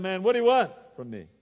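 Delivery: mock exasperated outburst; exasperated protest. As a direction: play a put-upon dad throwing it back. Voices a checked-out dad protesting.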